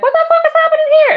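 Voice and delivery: very high, loud, rapid-fire disbelief; shocked outburst. Retold or imitated. Friend reacting to a video of a kiss, high shocked outburst. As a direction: shriek it fast in mock shock.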